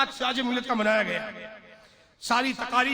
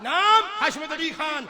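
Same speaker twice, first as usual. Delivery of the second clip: shouted, much higher and louder sermon climax; fiery preaching; 7 semitones higher, 11 dB louder than usual. Acted. Preacher vowing before the congregation, shouting with fervour. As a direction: roar the pledge to the crowd.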